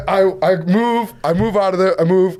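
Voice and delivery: ordinary talk, nothing marked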